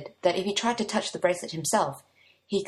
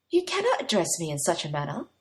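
usual speaking voice

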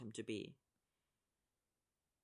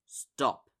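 growled stop; 8 dB louder than usual. A narrator's reading. Player snapping at a rival on ice, growled. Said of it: "he growls shortly".

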